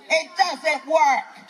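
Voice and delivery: slow, each word laid down deliberately; slow insistence. Real, not acted. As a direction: spell it out slowly for them. Repeating the point to crowd.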